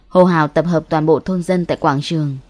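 ordinary delivery, nothing marked

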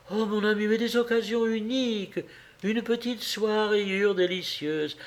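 high, coaxing justification; pleading excuse. A narrator's reading. Wife excusing her purchases to husband, pleading. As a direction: plead your case sweetly. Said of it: "avec son air de confusion suppliante".

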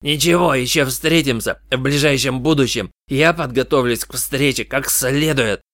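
menacing promise in a changed voice; angry threat. A narrator's reading. Beaten colleague warns the detective, angry threat. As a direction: threaten him with the next meeting. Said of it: "Он перешёл к оскорблениям".

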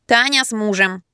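disguised, twangy nasal voice; nasal, pinched nose. A narrator's reading. Wife disguising herself to a caller, nasal. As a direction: talk as if pinching your nose. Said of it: "Я зажала нос пальцем и прогундосила".

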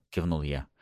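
ordinary delivery

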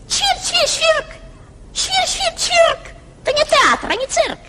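high, chirpy sparrow voice; chirping sparrow. Acted. Sparrow grumbling at the nightingale, high and chirpy. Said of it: "Na to wróbel zaterlikał".

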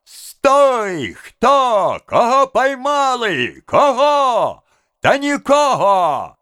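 high, stretched calls; urgent high-pitched cries; confused crowd shouting. A narrator's reading. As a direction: shout questions across a jostling crowd. Confused crowd shouting during the chase.

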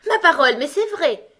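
loud, high, jolly surprise; cheerful exclamation. A narrator's reading. Gros Coco admits his mistake, cheerful. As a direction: own up gaily, delighted. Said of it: "dit gaiement le gros coco".

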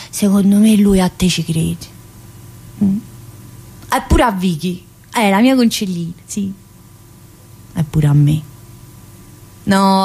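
ordinary talk, nothing marked

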